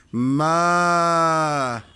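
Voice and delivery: long drawn-out mock moo; cow moo imitation. Shown as a demonstration. Showing little Güneş how cows cry, imitated moo. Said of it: "İnekler nasıl bağırıyor?".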